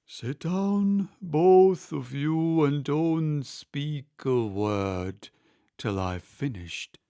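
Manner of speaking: slow, hollow, mournful turtle voice; deep, hollow tone. Acted. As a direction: drag the words out gloomily. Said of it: "in a deep, hollow tone".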